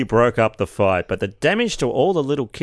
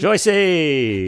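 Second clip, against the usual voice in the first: drawn-out, higher call; yelling out; 5 semitones higher than usual. Retold or imitated. Yelling out to Aunt Joyce after a burp, drawn-out call. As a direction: holler the name as if to another room. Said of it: "people in the other room would yell out".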